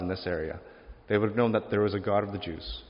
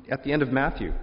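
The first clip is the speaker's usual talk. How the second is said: ordinary delivery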